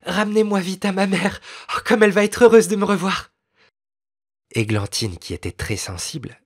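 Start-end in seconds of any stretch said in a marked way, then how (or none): 0.1-3.3 higher, louder, fast cry of joy through tears; tearful joyful cry. A narrator's reading. A lost girl begs to see her mother, crying out with joy. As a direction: burst out begging to go home. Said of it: "elle s'écria, les yeux remplis de larmes".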